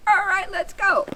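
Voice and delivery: high upbeat let's go; cheerful come-along. Shown as a demonstration. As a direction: call the puppy along cheerfully. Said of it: "walk the other way and say".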